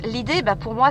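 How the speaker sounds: ordinary delivery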